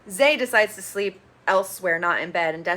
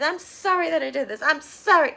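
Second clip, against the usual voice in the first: much higher, whinier sorry; mock childish whimper; 7 semitones higher than usual. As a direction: whine it like a sulking kid. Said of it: "So childish".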